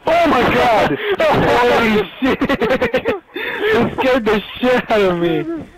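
jolted gamer yelling it out; hyped gamer bro. Real, not acted. Startled during game.

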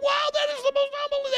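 very high, fast awed cry; amazed crowd. Acted. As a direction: gasp it out like a thrilled crowd.

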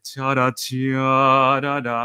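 sung syllables with the beat misplaced; mock the wrong stress on the syllables; da da rhythm showing the wrong stress. Shown as a demonstration. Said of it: "มันจะไม่เป็น".

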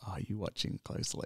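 soft, breath-only whisper imitating the line; quoted in a secretive whisper; hushed film quote. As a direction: whisper it like a film's opening hint. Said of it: "He's like".

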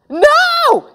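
high, loud cry of "no"; alarmed refusal. Acted. Little brother refusing the head shrinker, alarmed high shout.

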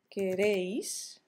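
queréis, drawn out slowly with the stress on "réis"; slow "queréis", stressed on the final syllable. Shown as a demonstration. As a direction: lean long on the E in "queréis". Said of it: "acento en la E".

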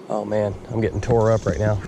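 ordinary talk, nothing marked

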